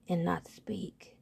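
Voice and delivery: soft whispered narration. A narrator's reading. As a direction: breathe it out.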